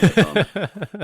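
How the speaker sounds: laughing through the words, slow and high. Real, not acted. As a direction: burst out laughing as you say it. Laughing at own blunder.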